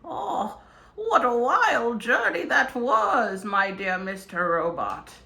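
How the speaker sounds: slow theatrical wizard voice; grand wizard, savoring each word. Acted. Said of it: "Because I'm a wizard".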